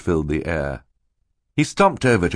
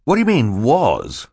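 higher, louder challenge; stern questioning; 6 semitones higher, 5 dB louder than usual. A narrator's reading. Mister Poe presses Stefano on one word, sternly. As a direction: demand an explanation sternly. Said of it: "asked sternly".

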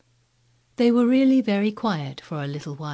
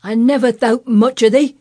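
very loud, higher furious rant; haranguing old Yorkshireman; 7 semitones higher, 11 dB louder than usual. Acted. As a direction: rant down at the girl. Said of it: "he harangued".

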